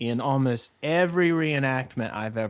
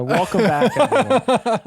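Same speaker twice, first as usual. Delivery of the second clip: radio host; 6 semitones higher, 5 dB louder than usual. Pretend. Mock morning show host.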